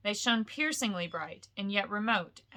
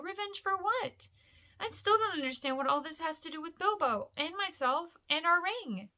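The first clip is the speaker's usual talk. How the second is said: put-on higher, bewildered young hobbit; young Frodo, puzzled; 9 semitones higher than usual. Frodo puzzling over Gandalf's words, higher bewildered voice. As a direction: ask it in confused disbelief.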